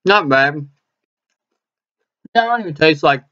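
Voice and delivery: chewing while talking; speaking around food; mouth full. Real, not acted. Seen 0: Bites taquito at -4; cheeks full, chewing while talking early on.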